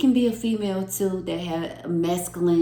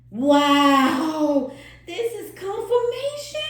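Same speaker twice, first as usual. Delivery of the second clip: high, loud burst of real excitement on "confirmation"; excited shout; 9 semitones higher, 5 dB louder, slower than usual. Real, not acted. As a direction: shout "Wow!" then fling "This is confirmation!" up high. Card reveal excites tarot reader.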